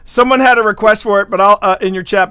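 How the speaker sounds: ordinary talk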